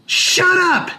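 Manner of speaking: put-on high, loud child's scream; child shrieking shut up. A narrator's reading. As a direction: scream it like a furious little boy. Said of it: "he screamed".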